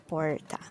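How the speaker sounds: porta with an English R; countryside R. Shown as a demonstration. Teacher shows the countryside porta, English R. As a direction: curl the R in porta. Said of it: "countryside of São Paulo would say".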